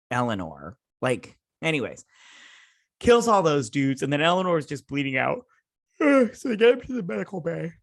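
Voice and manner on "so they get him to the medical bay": talking through a real yawn, high and quick; stifled yawn swallowing the sentence. Real, not acted. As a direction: let a yawn stretch the words. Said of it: "Sorry, I'm yawning".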